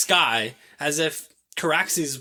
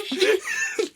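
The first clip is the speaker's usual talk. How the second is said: squealing high laugh. Real, not acted. Host laughing at her own joke, high squeal of laughter. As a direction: laugh freely.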